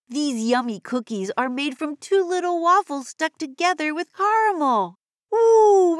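bubbly high cartoon kid voice; broad singsong kids theatre. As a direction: squeal it like an eager cartoon kid. High cheerful kid character voice.

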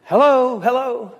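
high-pitched mock call; retold calling out. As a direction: act out searching for someone by calling. Acting out calling to unseen stranger.

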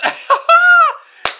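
a sudden high, loud laugh; real laughter; high laughing burst. Real, not acted. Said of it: "laughs at own line".

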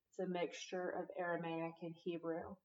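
normal talking voice

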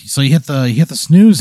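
ordinary talk

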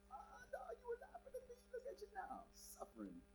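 high, quiet sneering taunt; mock smug believer taunting sinners; mocking gloater. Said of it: "so cocky".

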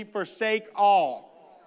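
very slow, stretched, emphatic; the preacher drawing out "Forsake all" for the people to repeat; call and response. Shown as a demonstration. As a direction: lead the congregation through it. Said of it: "would you say that with me?".

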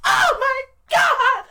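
slow, very high wailing oh my God; mom's dismayed cry. Retold or imitated. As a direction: imitate a mother's horrified wail. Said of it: "my mom goes".

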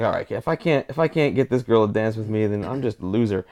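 low, soft and glum; dejected self-talk. Acted. Lonely man to himself before asking a girl to dance, dejected. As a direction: talk yourself up with little hope.

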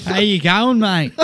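a friendly jokey Australian "How you going, mate?"; mock Aussie accent. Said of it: "his Aussie accent sound".

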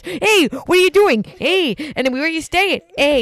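imitating a Canadian guard, high and loud with a slow "Hey"; hammed-up Canadian "Hey" on every question; Canadian border guard. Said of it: "the Canadian Border Patrol guy kept asking us".

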